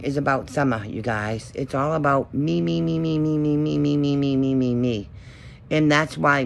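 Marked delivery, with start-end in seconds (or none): 1.6-5.1 mocking singsong chant; taunting sing-song voice. Mocking the narcissists' self-focus.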